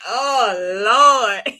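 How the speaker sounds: a gasped, loud Oh Lord, voice changed; shocked oh Lord. Retold or imitated. Host reacting to the ex showing up, shocked gasp. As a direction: react like you just saw the ex walk in. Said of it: "I was like".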